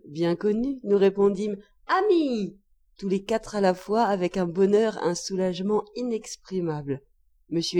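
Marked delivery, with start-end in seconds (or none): 1.9-2.6 high cry of relief; calling out. A narrator's reading. Four relieved family members answer father, calling out. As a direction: answer high, falling away at the end.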